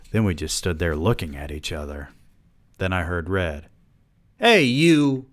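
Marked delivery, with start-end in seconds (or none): 4.4-5.3 high, loud angry call; yelled. A narrator's reading. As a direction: yell it across the shop. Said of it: "he yelled".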